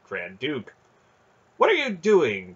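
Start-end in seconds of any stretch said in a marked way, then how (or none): 1.6-2.5 high, loud indignant question; indignant outburst; alarmed demand. A narrator's reading. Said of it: "Kador demanded of them".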